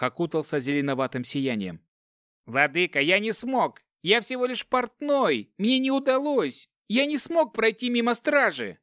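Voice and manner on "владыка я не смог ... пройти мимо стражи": high, desperate wailing plea; panicked pleading. A narrator's reading. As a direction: beg in rising panic. Dwarf panics before the necromancer. (в панике молит владыку)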